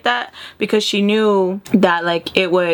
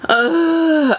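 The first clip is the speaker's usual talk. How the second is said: frustrated ugh; 9 semitones higher, 3 dB louder than usual. Real, not acted. Frustrated at coming drama.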